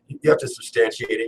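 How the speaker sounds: ordinary talk, nothing marked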